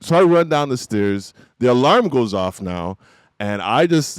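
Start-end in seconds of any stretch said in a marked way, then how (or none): none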